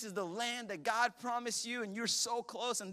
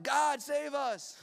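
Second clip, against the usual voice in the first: slow, higher pleading cry; mock plea; 7 semitones higher, much slower than usual. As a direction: cry out to God slowly. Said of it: "They're like".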